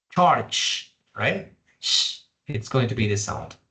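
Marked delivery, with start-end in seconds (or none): 0.1-0.9 airy, raised "Charge"; model "Charge". Shown as a demonstration. Teacher repeats the model "Charge" for students. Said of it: "deja pasar un poquito de aire".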